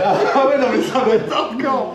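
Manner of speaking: laughing. Real, not acted. Nervous laughing before tasting.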